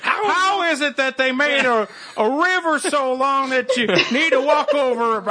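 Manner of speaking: put-on slow, high, baffled old man. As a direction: ramble it slowly like a puzzled old man. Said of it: "an old man in this, in the corner of every podcast now that goes".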